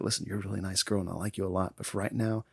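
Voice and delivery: low, soft retold reassurance; hushed firm aside. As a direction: quietly imitate a man shushing someone. Acted hushing of an upset woman.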